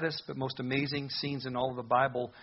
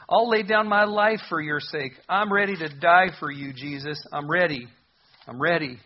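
loud, fervent vow, louder and higher at the start; bold Peter vow; 4 semitones higher, 10 dB louder than usual. Acted. Preacher voicing Peter's vow to Jesus, loud and fervent. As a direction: Peter swearing he will die for Jesus, loudly.

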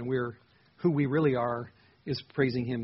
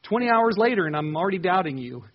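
high, loud, pleading cry of doubt; anguished doubt; 8 semitones higher, 7 dB louder than usual. Pretend. Believer crying out to an absent God, high and loud. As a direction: cry out to a God who seems gone. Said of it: "all of a sudden you feel like".

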